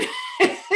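a burst of high laughter; real laugh. Real, not acted. Laughing at her son's teasing, a real burst of laughter.

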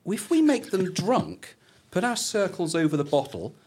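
comic high-pitched plotter; scheming Mysterons. Acted. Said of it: "Mister Ron's plan is".

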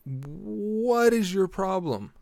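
high, drawn-out incredulous demand; exasperated confrontation. Retold or imitated. Confronts man holding tow chain.

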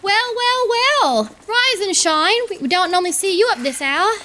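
high, cheery calling out; cheery cook voice. Acted. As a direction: call out warmly like a jolly cook. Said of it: "calls out to you in a cheery, rich voice".

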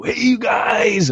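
high, slow, joyful shout; joyful greeting. Retold or imitated. Youth leader greeting the kids, joyful high shout. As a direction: belt out a cheery hello to the whole room. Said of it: "he was so filled with joy".

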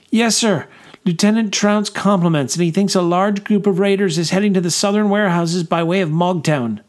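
high, eager soldier; young corporal voice. A narrator's reading. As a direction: answer the major crisply. Corporal reports to major.

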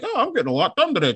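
high, quick self-mimicking chirp; contented office worker. Mimicking self working at the desk, high quick chirp. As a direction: rattle it off high and pleased with yourself. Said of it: "I'll be, like, clickety clacking".

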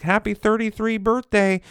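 high sing-song happy birthday wish; mock cheery birthday caption; birthday greeting. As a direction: chirp it like a cheerful owner posting. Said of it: "they were like".

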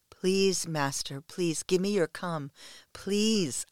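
pleading moan. Acted. A submissive begging her master, moaning. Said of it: "I moan".

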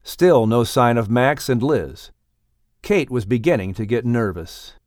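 measured audiobook narration, calm and clear; story narration. A narrator's reading. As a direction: read the chapter to listeners, setting the scene. Narrator begins reading the chapter.

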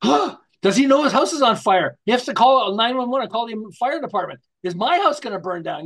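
sudden shock at the sight of flames; alarmed gasp. Acted. Driver seeing flames next door, gasping alarmed question. Driver spots a burning house.

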